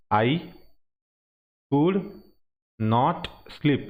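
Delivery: I, could, not sleep with long pauses between; word by word. Shown as a demonstration. Teacher breaks sentence apart for students, pausing. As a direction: break the sentence into separate words.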